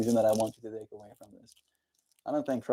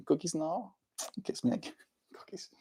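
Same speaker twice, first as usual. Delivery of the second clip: voicing the dog; 7 semitones higher, 11 dB quieter than usual. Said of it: "It's like".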